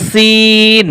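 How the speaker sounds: সিন with a thin hissing s and long 'ee'; slow, thin-toned সিন; light sin. Shown as a demonstration. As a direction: draw out a light, sharp সিন. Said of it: "এটা হচ্ছে পাতলা হরফ".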